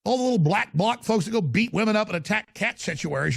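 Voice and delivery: ordinary talk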